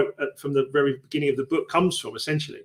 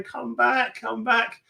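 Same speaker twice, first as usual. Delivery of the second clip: calling after her; 7 semitones higher, 4 dB quieter, much slower than usual. Retold or imitated. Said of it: "He's going".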